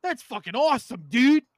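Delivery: high, hyped bro voice; Boston bro. Retold or imitated. Retelling his couch reaction in a high hyped bro voice. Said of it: "And I was like".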